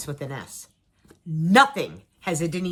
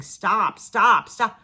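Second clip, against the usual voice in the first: mildly raised retold urging; imitated husband's plea; 2 semitones higher, 6 dB louder, much slower than usual. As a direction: cut in insistently, as a husband hushing tears. Husband begging her to stop crying.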